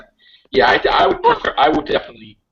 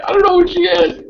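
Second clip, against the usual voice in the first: high, loud frantic denial; panicked denial; 5 dB louder than usual. Acted. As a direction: deny it in a panic, hammed-up. Doctor denies knowing Missy.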